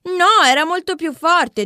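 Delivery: loud, slow, insistent answer; emphatic. A narrator's reading. Boy answers the teacher, loud and insistent.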